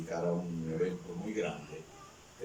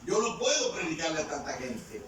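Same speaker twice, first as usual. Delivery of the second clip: acted overwhelmed protest, pitched high; retold pleading with God, high-voiced and insistent; desperate plea; 7 semitones higher than usual. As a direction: stumble over the first words, then plead helplessly. Preacher acts Cerulo pleading with God.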